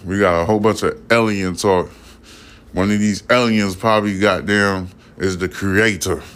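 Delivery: ordinary talk, nothing marked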